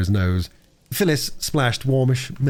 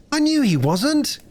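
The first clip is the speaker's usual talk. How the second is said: put-on very high, drawn-out child's voice; relieved little girl; slower than usual. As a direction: insist on it, high and slow. Phyllis relieved, about to cry.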